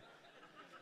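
quick unvoiced chuckle; a short airy laugh; breathy laugh. Real, not acted. Preacher laughing at his own story, a breathy chuckle.

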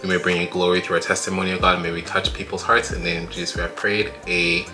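usual speaking voice

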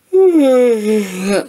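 long yawn breaking out, pitch rising; big yawning sigh. Real, not acted. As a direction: let a real tired yawn out. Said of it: "I keep yawning".

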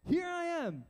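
high-pitched, ready reply; eager young Samuel. Acted. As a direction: answer as a boy running to his master. Acting out Samuel running to Eli.

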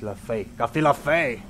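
slow, with the Andalusian accent creeping in; Andalusian accent slipping. Real, not acted. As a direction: let the Andalusian accent slip out, drawn out. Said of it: "Me va a salir, eh, el acento andaluz". (acento andaluz que se escapa, lento)

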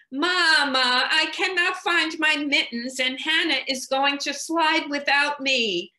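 put-on high, loud small-child voice, pleading through the door; a small child begging mama to help at once; little child calling. Small child calling to mama through the locked door, high and loud.

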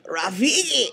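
practicing a cartoon voice; Rafiki. Shown as a demonstration. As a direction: lightly try out the character's voice. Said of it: "try to do Rafiki's voice".

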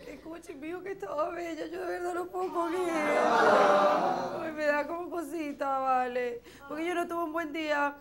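badly faked crying over a bad day; fake tearful. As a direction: put on a high, sobbing wail. Feigned weepy bad day, then admitted fake.

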